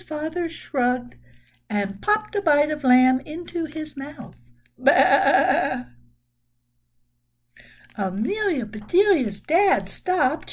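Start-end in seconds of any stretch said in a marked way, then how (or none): 4.8-5.8 loud nasal-free baa like a sheep; sheep bleat. Pretend. Sheep outside the window bleats during dinner, a loud baa.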